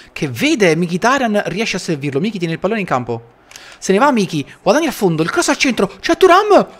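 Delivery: rising to a shouted goal call; breathless italian goal commentary. Real, not acted. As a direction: build up and yell the goal. Live goal call in match.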